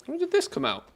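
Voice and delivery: very high astonished squeal; surprised squeak. Real, not acted. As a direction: squeal it in disbelief. Surprised by news post.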